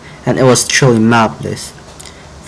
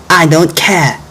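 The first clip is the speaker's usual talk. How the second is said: yelled; 5 semitones higher, slower than usual. Acted. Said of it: "Frog yelled once again".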